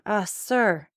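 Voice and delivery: plain reading voice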